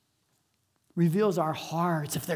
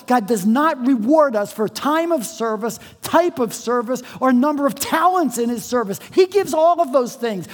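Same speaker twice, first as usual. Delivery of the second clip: higher, louder pulpit voice, pushing forward; full-force preaching; 7 semitones higher, 7 dB louder than usual. Real, not acted. Pastor telling senders they share the reward, fervent preaching. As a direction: preach it with rising fire.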